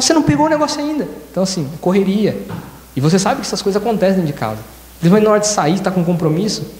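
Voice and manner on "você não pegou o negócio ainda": fast, higher complaint; irritated scolding. Retold or imitated. Rushing spouse scolding someone at home, fast irritated complaint. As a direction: snap at them in a hurry.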